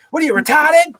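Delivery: hammed-up high-pitched incredulity; mock outraged exclamation. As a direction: exclaim it high, imitating old street talk. Acting out old heated slang.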